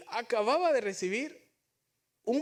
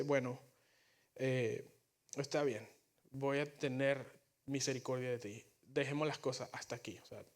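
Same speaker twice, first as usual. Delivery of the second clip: merciful king; 4 semitones lower, 4 dB quieter than usual. Retold or imitated. The king forgiving his servant's debt, soft and gentle. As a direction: lower and softer, gently granting pardon.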